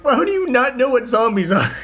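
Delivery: high-pitched mock exasperation; imitated flipping out. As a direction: imitate someone losing it in disbelief. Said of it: "just him flipping out".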